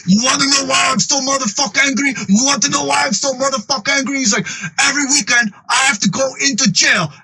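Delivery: very high-pitched angry rant; hammed-up, strained tirade held high all through; Frank. Enraged tattoo parlor owner ranting at his friends, high frantic anger.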